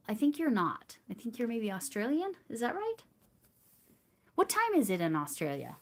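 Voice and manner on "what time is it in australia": normal talking voice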